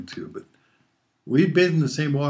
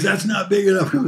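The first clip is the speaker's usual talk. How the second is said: higher, louder stern mom voice; mom scolding; 9 semitones higher, 6 dB louder than usual. Retold or imitated. Mother rejecting the stick, a loud high scolding. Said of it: "She says".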